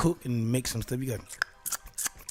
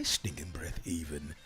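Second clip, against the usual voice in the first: whisper; 10 semitones lower, 7 dB quieter than usual. Acted. Whispered self-joke.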